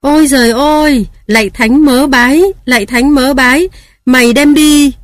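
high, loud cry of dismay; loud wail. Acted. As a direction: wail it out to the heavens. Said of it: "Bà tôi kêu to tướng".

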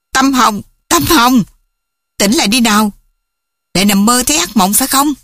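concerned mother calling her daughter awake; waking someone. Acted. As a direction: rouse a sleeper from a nightmare.

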